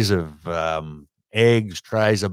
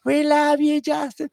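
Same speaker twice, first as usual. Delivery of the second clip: very high falsetto fan chant; mock girlish falsetto, drawn out; screaming fan girls; slower than usual. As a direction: squeal adoringly like a crowd of girl fans. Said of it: "all the girls are lined up along the banks".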